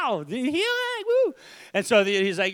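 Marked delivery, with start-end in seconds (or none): 0.0-1.3 high, slow hammed-up ecstatic cry; playful revival-meeting whoop; mock ecstatic. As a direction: wail it like being overcome with healing. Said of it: "You know, like".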